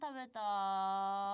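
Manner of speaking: sleepy, very slow mumble; barely awake, dragging the word; low energy. Acted. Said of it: "ローテンションなところ".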